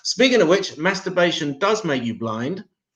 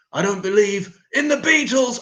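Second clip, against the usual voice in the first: higher, louder sung-out shout; shouted quote; 9 semitones higher, 5 dB louder than usual. Retold or imitated. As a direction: belt the line as the outrageous one. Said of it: "that's the offensive bit".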